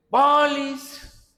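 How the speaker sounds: high, drawn-out hawker's call; sing-out sales shout on a held high note; street vendor cry. Retold or imitated. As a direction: imitate a vendor calling through the neighbourhood. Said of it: "empieza a gritar todo el vecindario".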